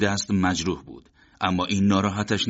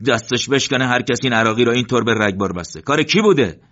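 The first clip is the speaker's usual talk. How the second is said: loud angry shout climbing higher; 4 semitones higher, 6 dB louder than usual. A narrator's reading. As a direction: bellow it across the battlefield. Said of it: "داد زد".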